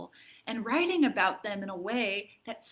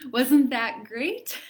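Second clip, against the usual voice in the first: laughing, louder and slow; 4 semitones higher, 7 dB louder, slower than usual. Real, not acted. Teacher to class after the poem, laughing. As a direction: laugh through the question.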